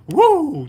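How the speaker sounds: high, loud "woo"; sudden whoop like someone jumping up; imitated whoop. Driver mimicking the man climbing out, loud whoop.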